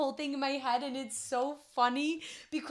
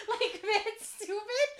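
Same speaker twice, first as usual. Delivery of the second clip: laughing; 7 semitones higher, much slower than usual. Real, not acted. Laughing at own silliness.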